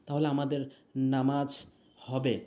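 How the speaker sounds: ordinary talk, nothing marked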